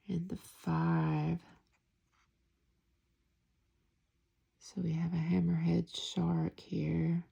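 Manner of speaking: soft whispered card walkthrough; hushed ASMR tarot flip-through. Real, not acted. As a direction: whisper each card like a secret.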